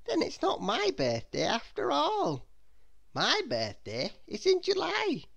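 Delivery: high, swooping Miss Scatterbrain voice; surprised realization. A narrator's reading. Little Miss Scatterbrain realizes her birthday mistake, surprised. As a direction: work it out aloud, pitched high. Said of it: "very much surprised".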